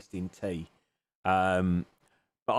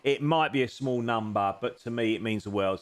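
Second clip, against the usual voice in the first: usual speaking voice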